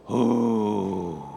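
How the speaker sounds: long low drawn-out ooh; a deep groan of disapproval; low mock groan. Sermon aside before grumbled.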